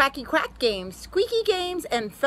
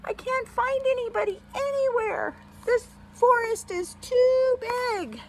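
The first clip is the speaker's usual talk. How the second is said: high, worried little bunny voice; high-pitched small bunny in distress; little lost bunny; 10 semitones higher than usual. A narrator's reading. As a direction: play a lost little bunny, stretching the last words. Lost bunny speaks in story.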